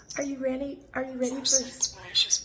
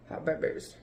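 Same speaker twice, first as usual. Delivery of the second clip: deep voice; 8 semitones lower, 5 dB quieter than usual. Real, not acted. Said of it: "I like it when you do your deep voice".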